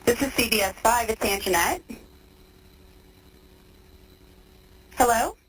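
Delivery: tinny phone-line voices; payphone call. Real, not acted. Recorded jail phone call.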